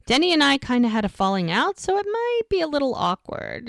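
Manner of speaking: retold uneasy confession, trailing up high; awkward admission. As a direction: voice climbing high and softening. Retold hesitant admission of falling out.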